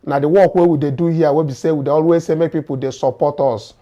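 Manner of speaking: ordinary talk, nothing marked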